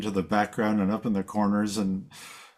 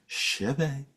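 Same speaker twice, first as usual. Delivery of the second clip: higher, softly modelled chevet as sheh-VAY; nice pronunciation-guide woman; 4 semitones higher, 4 dB quieter than usual. Retold or imitated. Imitating the online woman who says the word, higher careful voice. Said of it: "a very nice woman saying".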